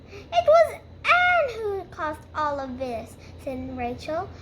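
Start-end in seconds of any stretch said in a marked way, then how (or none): 0.1-3.1 high, loud, wailing complaint; acted whining outrage, shifting the blame; indignant protest. Rachel protests and blames Anne.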